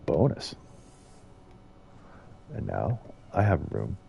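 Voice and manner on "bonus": usual speaking voice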